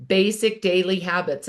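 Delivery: ordinary talk, nothing marked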